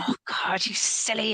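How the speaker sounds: unvoiced whisper, scolding herself; whispered self-scolding. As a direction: hiss it at yourself like a private telling-off.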